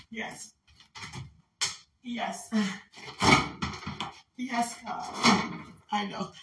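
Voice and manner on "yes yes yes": ordinary talk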